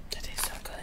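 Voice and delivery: hushed whisper of delight. Real, not acted. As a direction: breathe it out in a whisper. Whispered praise while eating.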